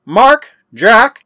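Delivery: loud, high rising calls; calling out. A narrator's reading. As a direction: call names up a staircase. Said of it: "he called".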